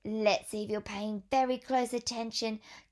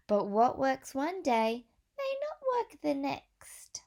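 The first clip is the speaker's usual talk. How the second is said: put-on reading to children. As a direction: clear storybook read-aloud voice. Reads picture book to children.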